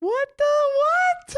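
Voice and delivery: high, slow squeal of disbelief; stunned surprise. Real, not acted. Hearing a wild plot twist from a friend, stunned squeal. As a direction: let it pitch way up in shock.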